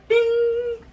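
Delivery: high bright ding; ding sound effect. Acted. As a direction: sing out a scoreboard ding. Scoring a point.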